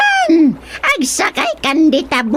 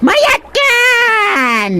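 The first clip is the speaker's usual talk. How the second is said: eager shout; 10 semitones higher than usual. Acted. Creature urging rider to climb on.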